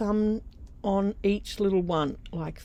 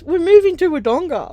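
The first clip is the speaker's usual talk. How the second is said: loud, high, emphatic retold announcement; spelling it out; 10 semitones higher, 12 dB louder than usual. Daughter finally telling mum the news outright, loud and emphatic. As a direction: say it loudly and plainly, voice pitched up. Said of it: "I'll just say it plain".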